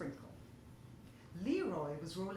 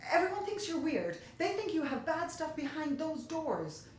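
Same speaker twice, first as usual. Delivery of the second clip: high, loud, rushed outburst; a nervous kid's blurt, higher and louder; 7 semitones higher, 4 dB louder than usual. Acted. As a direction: let it spill out before he can stop. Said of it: "he blurted out".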